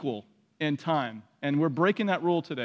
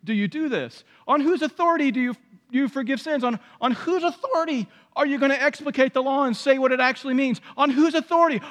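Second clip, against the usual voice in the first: challenging Pharisees; 8 semitones higher, faster than usual. Retold or imitated. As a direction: hurl the question like an accuser. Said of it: "the Pharisees said to you".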